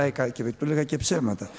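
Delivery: ordinary delivery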